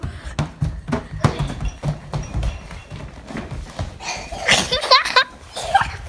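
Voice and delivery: high shrieking laugh; loud laughter. Real, not acted. Found hider, laughing.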